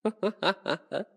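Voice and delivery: brief villainous chuckle; mocking laugh. Vampire mocking hunter's hopes, chuckling.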